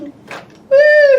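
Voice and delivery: high, loud whoop; bright excited woo; excited cheer. Real, not acted. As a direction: cheer the toy on. Cheering toy down slide.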